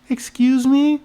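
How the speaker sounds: put-on high, polite little-kid pipe-up; bespectacled kid. Kid interrupting from across the hall, high child voice. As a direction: pipe up like a small boy. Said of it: "It was the bespectacled kid".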